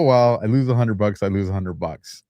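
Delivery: usual speaking voice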